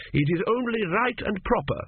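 high, insistent retort; pointed echo. A narrator's reading. Young king echoes the priest's own words, pointedly high.

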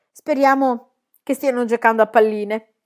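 usual speaking voice

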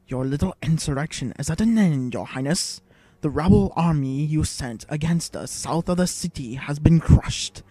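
put-on accented villain voice, self-satisfied; gloating captor viceroy. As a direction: play a pompous captor addressing his prisoner.

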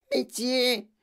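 high, drawn-out plea; cried out. A narrator's reading. Grandmother after the roof caves in, crying out. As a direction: cry for mercy in a high voice. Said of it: "cria grand-maman Joséphine".

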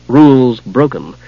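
ordinary delivery, nothing marked

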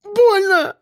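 very high squeal of pain; pained shriek. A narrator's reading. Woman's hair torn by a branch, pained shriek. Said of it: "и она завизжала".

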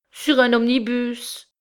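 high, slow, upset voice; tearful answer. A narrator's reading. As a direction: answer slowly, close to tears. Wife answers cousin, tearful.

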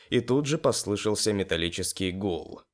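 usual speaking voice

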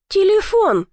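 high, loud shout of realization; sudden alarmed cry. A narrator's reading. Granddaughter suddenly remembering her phone, crying out in alarm. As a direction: blurt it out in a sudden panic.

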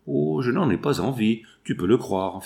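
plain reading voice

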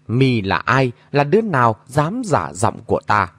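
plain reading voice

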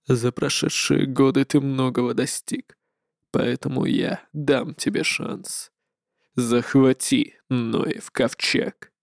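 low, soft, slow menacing voice; dark overlord's slow order; shadow lord. Acted.

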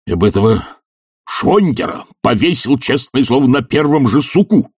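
high, heated outburst; furious exclamation. Acted. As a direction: exclaim it in rage, pitch climbing. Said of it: "воскликнул Филипп Филиппович, яростно".

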